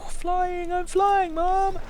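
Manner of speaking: high squeaky excited cry; silly high squeal. Pretend. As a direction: squeal it like a thrilled kid. Wingsuit jump, silly high voice.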